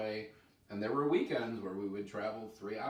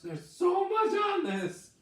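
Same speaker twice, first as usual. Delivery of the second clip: high yelling shout; pained shout; 7 dB louder, slower than usual. Real, not acted. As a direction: cry out in pain. Hot sauce overload, shouting.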